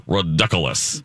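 ridiculous said as "ro-DUCK-u-lous"; Alexis's pronunciation. Retold or imitated. Host quoting co-host's way of saying ridiculous, as "ro-DUCK-ulous". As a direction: borrow a quirky "rod-DUCK-ulous" for ridiculous. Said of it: "as Alexis would say".

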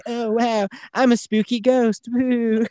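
high, goofy cartoon ghost. The cave ghost greeting visitors over the PA, high and goofy. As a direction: ham up a silly spooky ghost, high. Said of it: "it's Trey Parker's voice".

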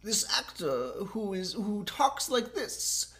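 high, slow, halting actor impression; Christopher Walken. Player describing an actor to a guesser, slow halting impression. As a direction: stretch the words out with odd gaps. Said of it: "It's Christopher fucking Walken".

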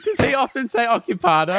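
high loud laugh-cry question. Real, not acted. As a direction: ask while still cracking up.

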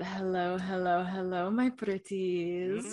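slow, drawn-out creepy welcome; lightly hammed-up witchy greeting, stretching my pretties; spooky host greeting. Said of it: "I think I should just say it".

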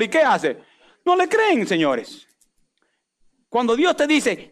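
ordinary talk

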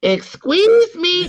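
high, slow, squeaky; hammed-up falsetto; mock high squeak. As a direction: squeak it out like a cartoon. Continuing the movie impression.

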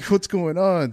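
laughing through the question; half laughing in disbelief; real laughter while asking. Real, not acted.

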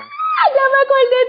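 loud, high-pitched squeal of recognition; shrieked aha moment; excited realization. Real, not acted. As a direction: burst out as the memory hits you. Suddenly remembers the tweet.

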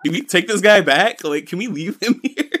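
high-pitched doubtful questions, tipping into laughter; high, giggly mock worry; amused mock reluctance. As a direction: imitate wary comrades while cracking up. Wary comrades voiced, teller laughing.

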